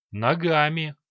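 plain reading voice

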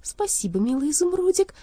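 sugary, effusive thanks, leaping high; syrupy, pitch soaring on the endearment; heartfelt. A narrator's reading. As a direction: gush with exaggerated feeling. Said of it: "прочувствованно сказала она".

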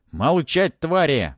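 loud, high order for silence; sharp shout. A narrator's reading. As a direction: master yelling at his minions. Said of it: "прикрикнул Осирис".

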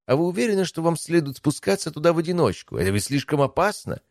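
high-pitched, concerned guard commander; worried subordinate. A narrator's reading. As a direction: object anxiously but respectfully. Guard anxiously objects to emperor's plan.

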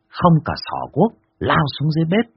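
ordinary delivery, nothing marked

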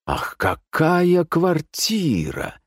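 high, slow gushing wonder; rapturous admiration. A narrator's reading. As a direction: draw it out, swooning over the place. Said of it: "принялся восхищаться Леонид Петрович". (восхищённо, протяжно)

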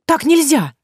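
high, loud protest; indignant girl. Acted. Vasilisa protests to Yaga, high and loud. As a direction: cry out against her.

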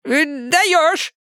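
put-on high squeaky old man; shrill agreeing squeak. A narrator's reading. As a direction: chime in with a squeak. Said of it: "поддакнул ему дед Лучок".

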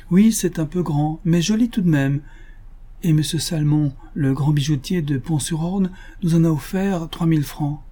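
high-pitched, pleased lady; proud Madame Matou. A narrator's reading. Lady boasting of her brooch, high proud voice. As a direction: show off the brooch with pride.

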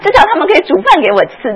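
high, loud, crying plea; whining widow. Retold or imitated. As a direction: wail like a self-pitying old mother. Preacher voices a self-pitying widow.

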